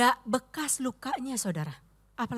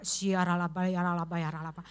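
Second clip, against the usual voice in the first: murmured mock speaking in tongues; mock tongues prayer; 5 semitones lower, 6 dB quieter than usual. Husband mumbling a tongues prayer under pressure. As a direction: softly babble a pretend tongues prayer. Praying in tongues, retold joke.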